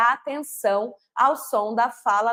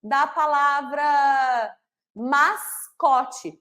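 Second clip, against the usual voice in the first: "mascote" drawn out slowly to show the s in the middle; word stretched slowly; much slower than usual. Shown as a demonstration. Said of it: "esse s tá lá no meio". ("mascote" bem devagar, destacando o s)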